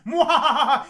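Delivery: high, loud mad scientist cackle; evil cackle. Acted. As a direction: throw your head back and cackle. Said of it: "Power mad".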